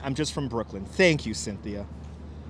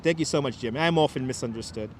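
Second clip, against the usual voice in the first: normal talking voice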